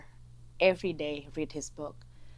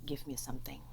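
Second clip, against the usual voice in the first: hushed, pleading prayer; quiet prayer; 3 semitones lower, 12 dB quieter, faster than usual. Retold or imitated. Woman praying alone over a borrowed book, hushed. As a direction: ask softly, almost whispering. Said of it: "I start praying".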